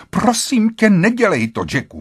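high-pitched urgent plea; pleading cry. A narrator's reading. Wife begs Jack not to go in, pleading cry.

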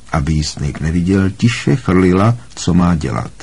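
usual speaking voice

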